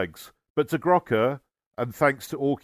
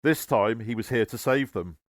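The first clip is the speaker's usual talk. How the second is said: usual speaking voice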